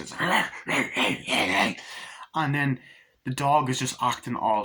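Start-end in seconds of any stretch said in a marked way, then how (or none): 0.2-1.7 imitated dog bark. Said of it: "they put in the dogs".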